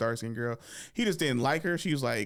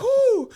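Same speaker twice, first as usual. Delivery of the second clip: very high, loud whoop; 12 dB louder than usual. Acted. Boy laughing his head off at cousin, whooping. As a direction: let out a big hoot of amusement. Acts out helpless reaction to joke.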